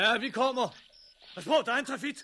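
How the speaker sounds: high, loud urgent shout. Acted. Bill warns the man about a triffid, urgent high shout. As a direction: yell a warning across the yard.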